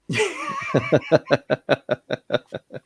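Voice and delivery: high, rapid giggling laugh; sustained high laughter; long laugh. Real, not acted. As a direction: keep laughing at your own joke.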